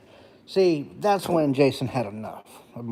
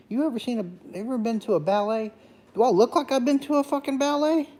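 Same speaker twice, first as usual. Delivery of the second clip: incredulous; 10 semitones higher than usual. Retold or imitated. Mocking the ballet question.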